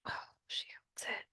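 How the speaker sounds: unvoiced whisper of dismay. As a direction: breathe it out, realizing too late. Realizing the sending stone limit.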